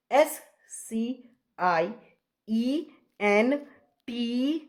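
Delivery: very slow letters with pauses between; spelling out. Real, not acted. Teacher writing out the Latin word, very slow spelling. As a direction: spell it out at writing speed, stretching each letter.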